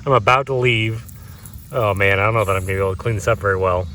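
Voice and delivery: normal talking voice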